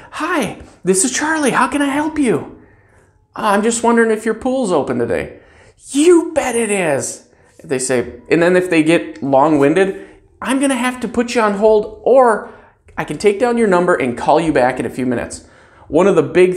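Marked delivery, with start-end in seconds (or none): 0.1-2.6 high, cheerful greeting; happy to help; bright phone greeting. Shown as a demonstration. Clerk greets a caller brightly. 3.4-5.4 caller voice. Shown as a demonstration. 5.9-7.3 cheerful reply. Shown as a demonstration. Clerk's cheerful answer. 10.4-15.4 polite hold request. Shown as a demonstration. Clerk to long-winded caller.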